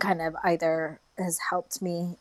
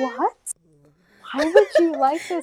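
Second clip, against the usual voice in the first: mock-incredulous, pitched high; higher-pitched, amused disbelief; baffled; 11 semitones higher, 5 dB louder than usual. American reacting to praise of their accent, baffled.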